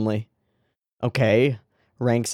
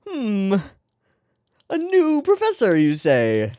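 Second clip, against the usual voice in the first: much higher mock-curious musing; hammed-up mock intrigue; mock sleuth; 9 semitones higher, 4 dB louder, slower than usual. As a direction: play the intrigued detective. Mock detective musing on new character.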